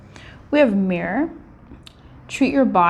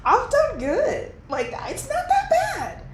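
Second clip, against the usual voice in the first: proud inner voice; 6 semitones higher, 4 dB quieter than usual. Pretend. Talking to her own reflection, a high proud pep talk. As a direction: cheer yourself on in the mirror, breaking into a laugh. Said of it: "look at that part of you and go".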